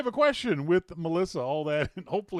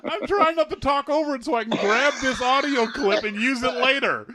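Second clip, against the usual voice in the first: high, loud words through laughter; host giggling through the line; laughing while talking; 9 semitones higher, 6 dB louder than usual. Real, not acted. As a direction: try to talk while cracking up.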